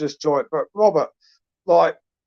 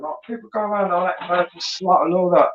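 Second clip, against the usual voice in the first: usual speaking voice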